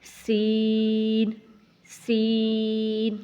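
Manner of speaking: سین long and slow, out of the nose; correct sin. Shown as a demonstration. Teacher repeats سین for practice. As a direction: model a slow clear سین. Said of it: "تو آپ نے اس کو تھوڑا سا time دینا ہے۔".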